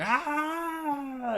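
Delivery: high, sudden squeal of joy; excited yelp. Retold or imitated. Fan spotting the Falcon on screen, high squeal. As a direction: let out a fan's delighted squeal. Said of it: "I was like".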